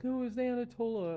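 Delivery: high, loud squeaky excuse; mock blame-shifting. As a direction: whine it out to dodge blame.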